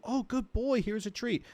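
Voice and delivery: high-pitched praise for a dog; mock-sweet pet-praise voice; cooing dog owner. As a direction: coo sweetly, as if rewarding a puppy. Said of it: "then you just go".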